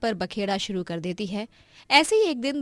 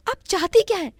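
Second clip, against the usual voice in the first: higher, louder indignant shout; angry outburst; 8 semitones higher, 5 dB louder, faster than usual. Acted. Maya shouting back at her sister-in-law, higher and louder. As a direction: hurl the questions back at her.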